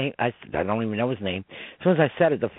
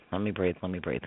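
fading much softer and lower; catching his breath; 6 semitones lower, 9 dB quieter than usual. Real, not acted. Medium asking to catch his breath, fading softer. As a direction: let it die away to almost nothing. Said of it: "Hold on, hold on".

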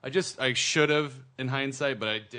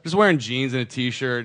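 ordinary delivery

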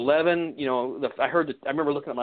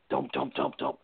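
heavy footsteps; 10 semitones lower, 5 dB quieter, slower than usual. Retold or imitated.

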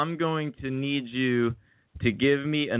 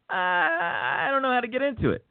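mock wail; 10 semitones higher than usual. Comic complaint about missing out on voiceovers.

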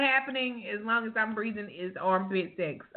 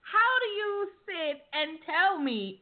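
indignant; 7 semitones higher than usual. Real, not acted. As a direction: demand it in disbelief. Indignant protest at a friend.

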